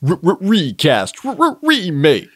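loud, high stutter-echo announcement; stuttering jingle. Pretend. Segment jingle.

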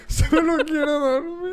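high, whiny fake sob; hammed-up weepy plea, laughing through it; mock crying. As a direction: whimper it like a child begging to sleep.

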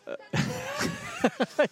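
high burst of laughter. Real, not acted. Commentator tickled by his co-commentator, high laughing. Teased about dancing at back.

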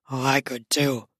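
low, strained male voice. A narrator's reading. Man holding back, strained. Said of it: "he says in a strained voice".

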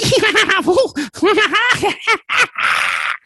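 shrill high cackling laugh; high cackle. Acted. The cackler laughing at the heroes, high cackle. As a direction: cackle like a mad creature.

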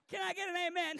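rousing church shout; preacher's call. Acted. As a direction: call out for an amen. Preacher calls congregation for an amen.

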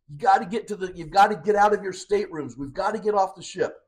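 high, urgent mock yell; retold screaming. Retelling captain warning passengers to leave, mock screaming. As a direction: raise the pitch as if warning everyone, short of a scream. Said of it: "And he's screaming".

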